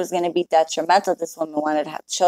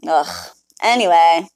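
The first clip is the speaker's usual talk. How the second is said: normal talking voice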